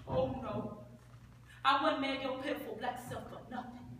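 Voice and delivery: low, quiet contempt; scornful refusal. Acted. Crystal finishing her refusal to Bo, scornful. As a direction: drop the voice and dismiss the suitor. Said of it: "Crystal said".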